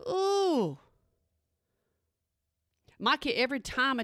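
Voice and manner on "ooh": startled ooh. Retold or imitated. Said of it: "I was like".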